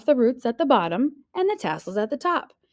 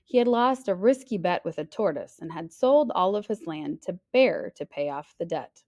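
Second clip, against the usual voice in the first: plain reading voice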